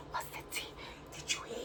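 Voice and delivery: whispered gossip, leaning in. Retold or imitated. Imitating gossipers passing on the news, whispered. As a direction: hush it like juicy tea.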